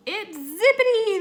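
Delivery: high, loud joyful shout; excited cry. Acted. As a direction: cry out in relief at finding him. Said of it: "they cried".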